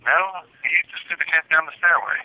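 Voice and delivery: ordinary talk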